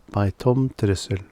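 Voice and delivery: ordinary delivery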